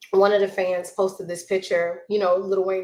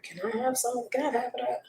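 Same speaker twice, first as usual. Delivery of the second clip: mock pleading; 5 semitones higher, 6 dB quieter than usual. Asking to share a man.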